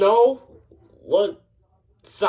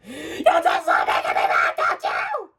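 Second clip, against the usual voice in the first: high, furious screech; shrieked angry accusation; emotional screaming; 9 semitones higher than usual. Acted. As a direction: explode into a high-pitched scream. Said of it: "No need to get so emotional".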